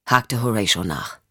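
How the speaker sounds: ordinary delivery, nothing marked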